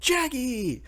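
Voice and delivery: high, delighted squeal of a name; happy greeting. Retold or imitated. Luffy spotting Kid, joyfully calling his name. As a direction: greet an old rival with pure glee. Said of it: "gets all happy".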